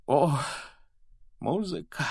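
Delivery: high, awed cry; rapturous exclamation. A narrator's reading. Headmaster wiping his eyes after the anthem, exclaiming. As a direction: exclaim it, moved by what was heard. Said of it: "воскликнул он, вытирая глаза".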